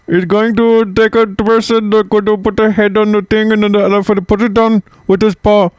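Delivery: put-on high-pitched, sing-out Indian accent; imitation Indian accent in a high voice. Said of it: "this is my Indian accent".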